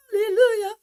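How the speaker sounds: high, loud hallelujah; joyful shout. Real, not acted. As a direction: shout it out in triumph. Celebrating that the sound works.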